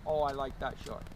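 usual speaking voice

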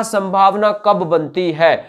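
usual speaking voice

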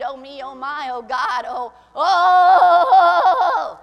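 hammed-up moaning wail rising loud; mock despairing moan climbing loud; mock defeated prayer. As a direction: parody a defeated pray-er. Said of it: "praying from a defeated attitude".

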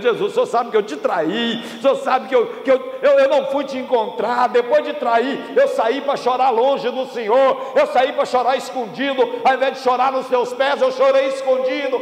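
high, anguished plea; acted tearful confession. Peter confesses betrayal to Jesus, acted tearful cry. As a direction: preach it as Peter weeping out his confession. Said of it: "Aí Pedro começou a chorar".

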